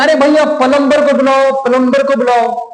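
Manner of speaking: high, level put-on voice of a customer; someone calling a plumber. Someone in need asks for a plumber, acted request. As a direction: raise the pitch and ask for the tradesman. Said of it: "तो बोलेगा".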